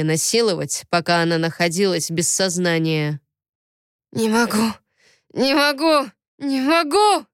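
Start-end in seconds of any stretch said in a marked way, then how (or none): 4.1-7.3 hoarse repetition rising in pitch; hoarse, delirious. A narrator's reading. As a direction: build from strained murmur to cry. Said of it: "как в бреду повторял пленник, совсем охрипнув". (хрипло, как в бреду)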